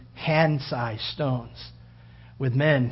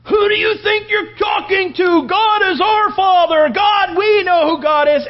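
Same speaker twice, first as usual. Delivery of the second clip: very high, loud furious shout; angry crowd shouting down the martyr; mob shouting; 11 dB louder than usual. Retold or imitated. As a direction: scream it as an outraged crowd.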